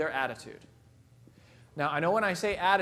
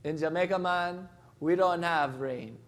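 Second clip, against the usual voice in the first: put-on Jamaican accent; slower than usual. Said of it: "and he goes".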